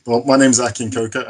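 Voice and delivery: usual speaking voice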